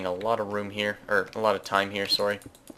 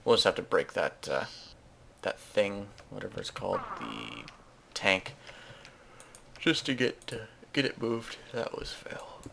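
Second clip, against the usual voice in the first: normal talking voice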